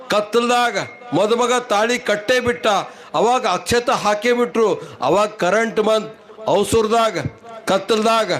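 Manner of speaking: usual speaking voice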